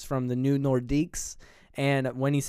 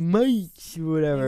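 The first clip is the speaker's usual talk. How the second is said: higher, louder mock old man; old man's accent; 4 semitones higher, 5 dB louder, slower than usual. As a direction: echo the old fellow's odd "Mates". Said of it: "he talks like that".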